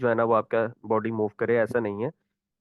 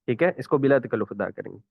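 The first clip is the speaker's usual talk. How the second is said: ordinary talk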